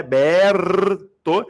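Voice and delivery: "Berto" with a long, heavy BERRR, then a separate to; sounded-out pronunciation. Shown as a demonstration. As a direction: stretch and punch the first syllable of "Berto". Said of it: "é pronunciado".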